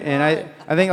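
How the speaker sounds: ordinary talk, nothing marked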